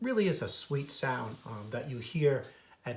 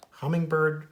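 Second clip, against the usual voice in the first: usual speaking voice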